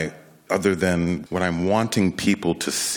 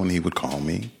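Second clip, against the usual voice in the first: ordinary talk, nothing marked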